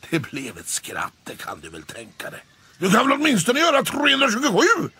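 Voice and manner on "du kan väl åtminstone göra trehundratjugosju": fast, high, loud heckling; quick loud jeer; mocking yell. A narrator's reading. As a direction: taunt him again from the crowd. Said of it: "skrek Måns".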